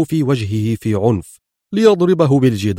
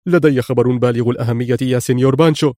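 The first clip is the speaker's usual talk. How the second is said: excited report; faster than usual. A narrator's reading. A henchman calls Pancho with news, lightly excited. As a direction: blurt out big news to the boss. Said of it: "يقول في انفعال".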